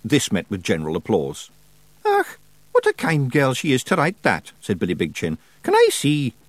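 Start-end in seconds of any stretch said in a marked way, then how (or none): 2.0-4.5 high, broad Scottish wee man, uneasy; fretting little pixie voice; worried Feegle. A narrator's reading. Billy speaks. 5.6-6.3 high, broad Scottish wee man, uneasy; fretting little pixie voice; worried Feegle. A narrator's reading. Billy speaks.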